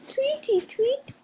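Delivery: very high, slow birdy voice; sing-song like greedy birds; birds chirping. A narrator's reading. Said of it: "went the birds".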